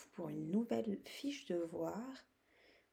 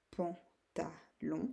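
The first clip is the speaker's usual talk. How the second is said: "pantalon" in three slow, separate syllables; 3 dB quieter, much slower than usual. Shown as a demonstration. Teacher giving pupils an example, syllable by syllable. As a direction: say "pan, ta, lon" slowly with gaps. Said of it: "taper dans les mains pour vous aider".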